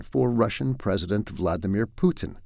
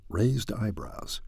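slow, softer, savoured aside; relishing the phrase; 7 dB quieter, slower than usual. Shown as a demonstration. Vlogger savouring a favourite phrase, slow soft relish. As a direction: roll raised eyebrows out slowly, enjoying it. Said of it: "I love that term".

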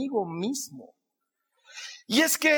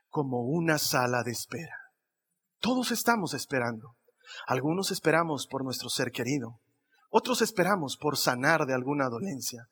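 mock profound; 6 semitones lower than usual. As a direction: play the deep thinker, half joking. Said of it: "me hago al profundo". (tono profundo de burla, más grave y suave)

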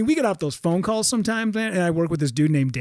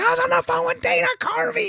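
much higher, breathless thrill; giddy excitement. Retold or imitated. Telling his brother-in-law about the call, giddy and high. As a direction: gush it, way up high.

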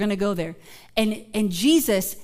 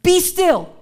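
high, loud command; Jesus rebuking the waves; commanding rebuke; 7 semitones higher, 7 dB louder, much slower than usual. Retold or imitated. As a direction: order the storm to stop. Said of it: "he rebuked the wind".

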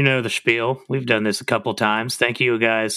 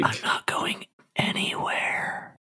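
playful whisper; 8 dB quieter than usual. Real, not acted. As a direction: whisper it teasingly. Playful aside after the ad.

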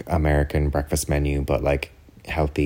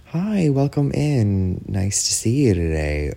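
restaurant greeter; 6 semitones higher, slower than usual. Retold or imitated. Server greeting diners at the table, high drawn-out welcome. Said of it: "the waiter or the server will come over".

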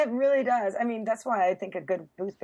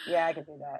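flat, low bored robot voice; 6 semitones lower, faster than usual. Retold or imitated. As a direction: answer like a bored machine. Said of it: "If people are thick, they don't get that, you know".

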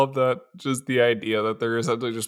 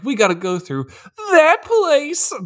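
very high, slow dramatic announcement; mock dramatic; slower than usual. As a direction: overplay a heroic declaration. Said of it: "it's like".

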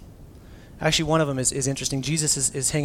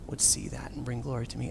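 whispered; 3 semitones lower, 7 dB quieter than usual. Retold or imitated. Ends in whisper.

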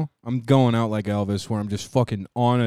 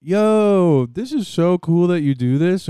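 excited gushing; 6 semitones higher, 4 dB louder, slower than usual. Retold or imitated. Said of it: "girls are gonna be like".

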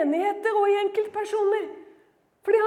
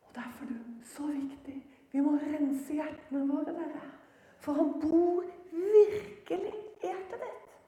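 low, near-whispered earnest plea; hushed preaching; 4 semitones lower, 9 dB quieter than usual. Real, not acted. Preacher urging listeners to cleanse their hearts, hushed.

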